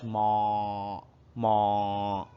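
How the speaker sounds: low lowing moo; cow moo. Shown as a demonstration. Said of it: "A cow says".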